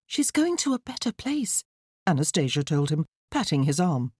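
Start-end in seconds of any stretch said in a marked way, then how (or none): 0.1-1.6 put-on high-pitched, consoling girl; little girl's high voice, consoling; young daughter. A narrator's reading. Daughter comforting her grieving father, high and consoling.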